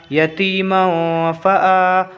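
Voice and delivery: "यतीमों फ़ा आ" slowly, "मों" hummed in the nose; ikhfa nasal hold. Shown as a demonstration. Teacher builds the word, ikhfa nasal hold. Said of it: "इसको भी वैसे ही पढ़ेंगे".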